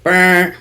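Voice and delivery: droning, evenly repeated buzzer noise; monotone alarm buzz imitation; imitated alarm. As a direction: sound it like a phone alarm, on one note. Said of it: "It's an alarm".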